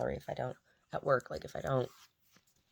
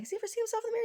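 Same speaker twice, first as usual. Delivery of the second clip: much higher, eager question; curious high question; much faster than usual. Retold or imitated. Asking the dad about the dog, high curious voice. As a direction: ask in wonder.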